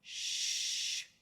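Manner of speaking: long, unvoiced "Sh"; shushing sound. Shown as a demonstration. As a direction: hush someone with a drawn-out "Sh". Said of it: "just like you're asking somebody to be quiet".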